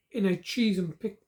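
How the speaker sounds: ordinary delivery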